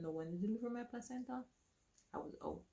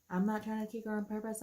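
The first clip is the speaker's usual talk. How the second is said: louder, indignant repeat; protesting in pain, louder; exasperated protest; 5 semitones higher, 6 dB louder than usual. Retold or imitated. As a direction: insist hard that it's not on purpose. Repeated defensively.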